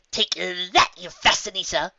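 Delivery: a touch of smug triumph; gloating. Acted. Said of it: "urge to gloat".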